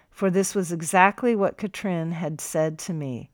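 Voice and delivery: ordinary delivery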